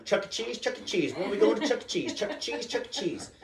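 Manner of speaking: put-on high, relentless kid chant; excited little daughter. Daughter begs dad all day, excited child voice. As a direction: imitate a pestering child in a high voice. Daughter pesters on waking at six.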